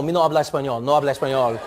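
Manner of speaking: smooth, low-pitched Spanish disclaimer; fluent Spanish accent. Retold or imitated. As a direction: deny it in too-good Spanish pronunciation. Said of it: "even the way I'm saying".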